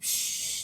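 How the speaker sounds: imitated soft hiss of stopping; brake hiss. Describing the coaster stopping, soft hiss. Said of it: "it went".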